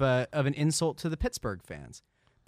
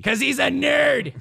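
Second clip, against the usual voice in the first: slow, loud, higher joking jab; teasing; 11 semitones higher, 7 dB louder, slower than usual. Real, not acted. Cohost jokes about Malkin, slow and loud. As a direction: land the joke.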